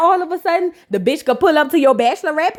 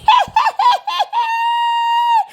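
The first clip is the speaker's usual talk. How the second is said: shrieking laugh. Real, not acted. Host cracks up at Heavenly, shrieking high laugh. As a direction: let a high laugh burst out.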